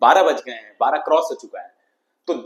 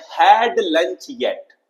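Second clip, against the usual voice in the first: slow model reading; 3 semitones higher, 3 dB louder, much slower than usual. Shown as a demonstration. Teacher models the sentence.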